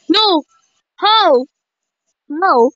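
short high-pitched cries; dismayed disbelief. Real, not acted. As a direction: cry out in sudden protest. Game escape suddenly fails.

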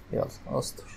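soft whispered plea; whispered prayer. Real, not acted. Gamer whispering a plea as the phone lags. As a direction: breathe it out as a hushed prayer.

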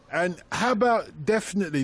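usual speaking voice

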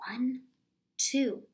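hushed counting whisper. Shown as a demonstration. Teacher whispering the count to children.

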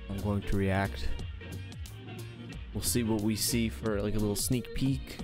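ordinary talk

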